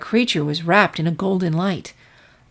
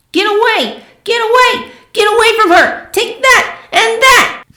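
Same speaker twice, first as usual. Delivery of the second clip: high loud furious yelling; fierce shout; 7 dB louder than usual. Acted. Filly fires magic at the monster, high angry shouting. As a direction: drive the monster off with every shot.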